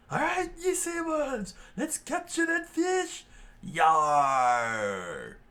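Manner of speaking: high, screechy pirate battle cry; screaming pirate. A narrator's reading. Pirate rallies the crew to attack, screaming high. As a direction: scream a pirate rallying call, drawing out the end. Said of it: "one of the pirates screamed out".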